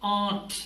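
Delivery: aren't with the r silent, like "aant"; aren't, drop the r completely; correct way. Shown as a demonstration. Teacher giving students the right aren't, r muted. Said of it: "So this is".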